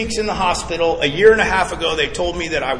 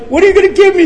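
high, loud worried ask; mock panic at the doctor; alarmed question; 10 dB louder, much faster than usual. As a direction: squeak it out, much higher and louder. Retelling asking the doctor.

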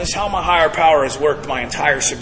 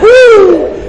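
loud, high drawn-out "woo"; high whoop; 10 dB louder than usual. Retold or imitated. Storyteller reliving the long walk, high whoop.

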